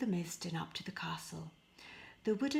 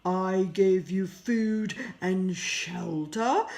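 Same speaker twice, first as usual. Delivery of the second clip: slow, louder monstrous beast voice; beast roaring; 9 dB louder, slower than usual. Acted. The beast catches Pierre stealing a rose, roaring slowly. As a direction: roar it out as a furious beast. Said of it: "roared the creature".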